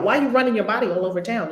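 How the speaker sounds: ordinary talk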